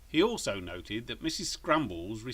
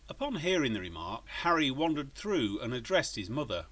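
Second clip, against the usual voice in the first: usual speaking voice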